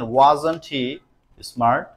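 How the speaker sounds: slow, word by word dictation. Shown as a demonstration. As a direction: read the sentence out slowly. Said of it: "slow dictation of example". (ধীরে ধীরে বলা)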